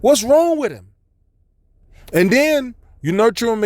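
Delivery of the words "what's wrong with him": raised, incredulous rant at a stubborn son; high, fed-up disbelief; exasperated parent. Retold or imitated. Parent fed up with son touching stove, high exasperated rant. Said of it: "You gonna be like".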